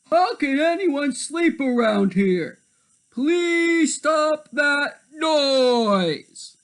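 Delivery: high, loud, very slow plea; high drawn-out complaint; exasperated leopard. Acted. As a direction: beg the animals to stop.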